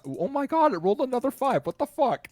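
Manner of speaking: high, loud disbelieving outburst; real astonishment. Real, not acted. Game master sees another bad roll, astonished. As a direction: exclaim in shocked disbelief, pitch way up.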